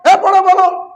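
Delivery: high, loud sharp scolding; scolding teacher. Retold or imitated. Teacher scolding an unprepared student, loud scolding. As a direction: bark the order at a scared pupil. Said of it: "ঝাড়ি দিয়ে বলে".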